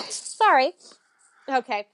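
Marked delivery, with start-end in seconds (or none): 0.1-0.7 sudden sneeze, then a quick sorry; sneezing. Real, not acted. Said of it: "I have to sneeze".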